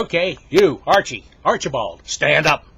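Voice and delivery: loud, high, commanding call; stern teacher summons. Acted. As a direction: shout the pupil up by name. Teacher summons Archie to recite.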